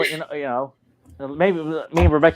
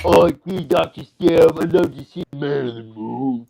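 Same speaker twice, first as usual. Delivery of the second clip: eager, raised goofy sidekick voice; gushing henchman, pitched up; Mechanic voice; 3 semitones higher, slower than usual. Acted. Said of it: "Do the mechanic".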